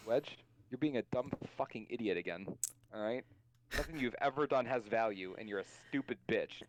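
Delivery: low, sneering put-down voice; lightly voiced mean inner critic; mocking inner critic voice. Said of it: "this is exactly how it goes".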